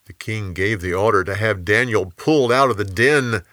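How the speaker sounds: usual speaking voice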